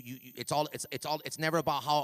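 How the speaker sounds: ordinary talk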